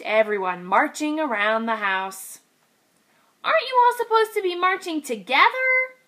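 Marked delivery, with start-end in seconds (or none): 3.4-5.9 high, quick piglet question; puzzled small voice; little pig. Acted.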